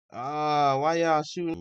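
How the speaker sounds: loud, high cry of frustration; frustrated outburst. Real, not acted. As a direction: cry out at the missed trade, voice jumping up. Frustrated over a missed trade entry.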